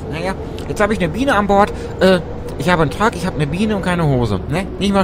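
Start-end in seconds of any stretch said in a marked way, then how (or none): none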